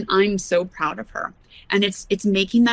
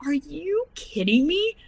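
incredulous retort; 7 semitones higher than usual. Retold or imitated. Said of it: "And I'm like".

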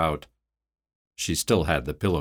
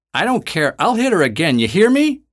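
high, loud shouted threat, climbing higher into you hear me; bully her with your voice; angry shouting; 12 semitones higher, 7 dB louder than usual. Acted. Woman threatens to hit again.

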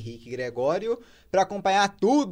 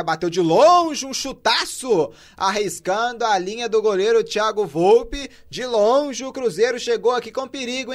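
high, loud, drawn-out live call of a long shot; explosive goal commentary; 9 semitones higher, 7 dB louder than usual. Real, not acted. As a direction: shout the near miss with rising excitement. (narração explosiva de lance de perigo)

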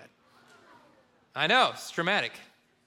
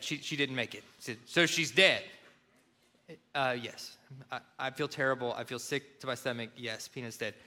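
much softer, low and heavy; guilty quiet confession; 5 semitones lower, 5 dB quieter than usual. Retold or imitated. Son telling dad the dog is dead, quietly ashamed. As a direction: admit it with shame.

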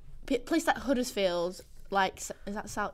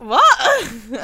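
loud laughing disbelief; mock bewildered outburst; baffled; 11 dB louder than usual. Reacting to housemate's dialect, loudly baffled. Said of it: "I'm like".

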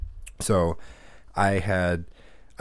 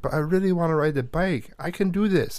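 put-on higher, whiny kid plea; pleading little boy; 8 semitones higher than usual. Little Trent pleading with his brother, higher whiny kid voice. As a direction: beg like a small child set on riding. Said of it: "Trent's like".

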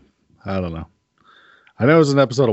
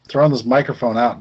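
ordinary talk

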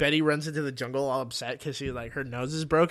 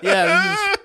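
much higher, louder gushing; high excited cheer; 9 dB louder than usual. Retold or imitated. Friends cheering the fashion show result, high excited gush. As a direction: gush like thrilled fans. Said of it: "And then they're like".

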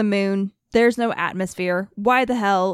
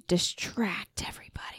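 secretive conspiracy whisper; 3 semitones lower, 11 dB quieter than usual. Acted. Conspiratorial aside about the government.

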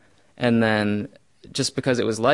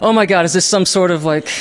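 laughing alarm; 7 semitones higher, 8 dB louder than usual. Retold or imitated. Retold alarmed thought.

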